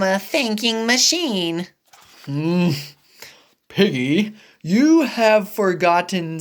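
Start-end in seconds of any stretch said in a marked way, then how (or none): none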